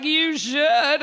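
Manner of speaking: loud, pushy nudge; loud mock insistence; insistent urging. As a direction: push her hard to do it. Friend urging her to write.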